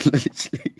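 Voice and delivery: high burst of laughter on the word; laughing. Real, not acted. Laughing at her own wrong answer to the soldiers.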